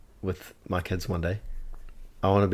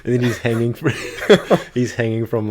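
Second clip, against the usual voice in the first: high, loud, breaking up with laughter; laughing; 4 semitones higher, 10 dB louder than usual. Real, not acted. Repeating friend's joke about the elf, laughing hard. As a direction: barely get it out for laughing.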